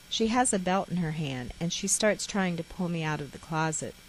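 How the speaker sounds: ordinary delivery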